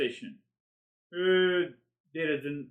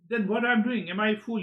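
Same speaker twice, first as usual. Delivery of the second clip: mock self-questioning; 7 semitones higher, 5 dB louder than usual. Voicing the self-questioning seeker.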